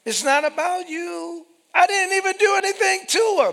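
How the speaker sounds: high, loud, fast protest; indignant protester. Acted. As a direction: protest your innocence loudly. Offended person protests innocence.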